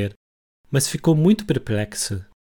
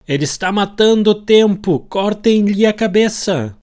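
high, loud royal command, slow at the end; furious queen shouting; 11 semitones higher, 8 dB louder than usual. Retold or imitated. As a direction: bellow it like an angry queen. Queen condemning, retold by Hatter.

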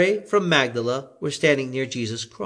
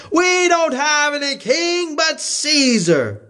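higher, slow declaration; priests answering; 13 semitones higher, slower than usual. A narrator's reading. The ruling priests to Pilate, higher and slow.

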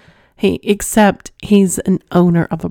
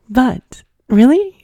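surprised; 7 semitones higher, 5 dB louder, slower than usual. Real, not acted. Surprised listeners get off to her.

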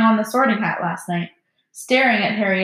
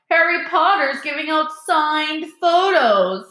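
roared to crowd; 7 semitones higher, 4 dB louder than usual. A narrator's reading. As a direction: proclaim it mockingly for everyone to hear. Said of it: "Malfoy roared to the crowd".

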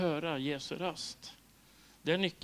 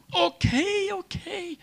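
startled giving in; 6 dB louder, much slower than usual. Retold or imitated. Giving in to the command.

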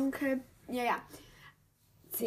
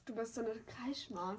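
teasing whisper; 3 semitones lower, 14 dB quieter than usual. Real, not acted. Teasing friend about screaming.

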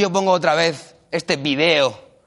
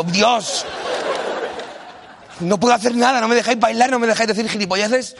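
queja lloriqueante; 5 semitones higher than usual. Retold or imitated.

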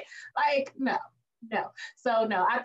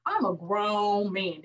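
imitating Denzel; much slower than usual. Fan imitating Denzel's grown-man swagger, slow. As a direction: drawl it like a proud grown man. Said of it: "He's like ... Denzel is".

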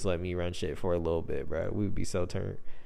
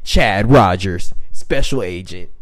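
slow, loud, dramatic intro of a special agent; a light, mock trailer-voice for the agent; announcer-style name drop; 2 semitones lower, 9 dB louder, slower than usual. Inventing an FBI agent name.

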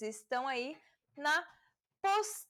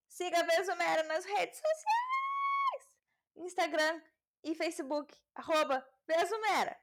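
hyped plug; 5 semitones higher than usual. Pretend. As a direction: stretch the last word out high. Hyped plug for social media.

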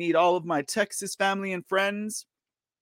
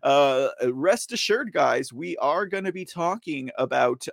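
ordinary talk

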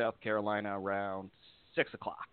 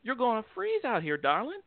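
high mock southern granny voice; sweet old southern lady; 13 semitones higher than usual. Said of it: "This little old lady comes out".

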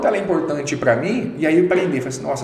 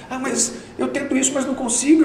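put-on higher, complaining quote of the girls; whiny quote; 6 semitones higher than usual. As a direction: whine it like a discouraged employee. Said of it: "as meninas falam assim".

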